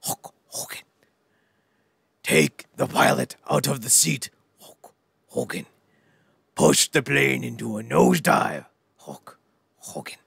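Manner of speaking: slow, snarling wrestler promo, dropping to unvoiced rasps; Ultimate Warrior. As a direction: growl each phrase like a raving wrestler. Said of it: "That's the Ultimate Warrior snarl".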